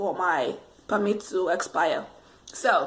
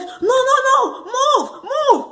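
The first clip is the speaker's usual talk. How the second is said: much higher, urgent no no move; frantic command; 14 semitones higher, 4 dB louder, slower than usual. Retold or imitated. Imitating the examiner ordering her to move, frantic and high. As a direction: yelp it like a scared passenger. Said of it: "He was like".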